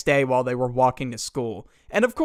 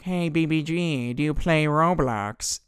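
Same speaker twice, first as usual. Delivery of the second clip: put-on creepy suitor voice; mock smooth talker. Kid privately messages Crystal, mock smooth-talker voice. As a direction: imitate a would-be charmer sliding in.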